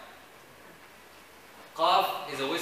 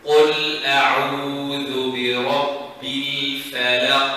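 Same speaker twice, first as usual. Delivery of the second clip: chanted recitation, قل and أعوذ drawn out; teacher reciting for students to copy; Quran recitation; 2 semitones lower, 4 dB louder, slower than usual. Shown as a demonstration. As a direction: intone it like tajweed practice.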